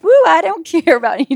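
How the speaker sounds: high, loud, laughing reenactment; carefree whoop. Retold or imitated. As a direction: whoop it out with comic abandon. Acts out uninhibited labour whooping.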